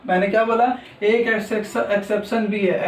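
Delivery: normal talking voice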